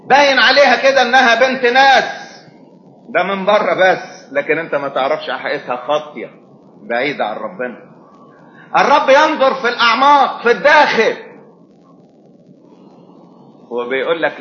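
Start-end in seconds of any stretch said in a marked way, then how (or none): 0.1-11.3 high, loud preaching with sharp drops; raised sermon voice warning the congregation; fervent preaching. Acted.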